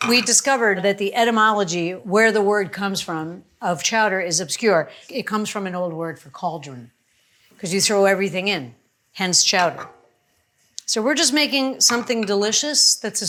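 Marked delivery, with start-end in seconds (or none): none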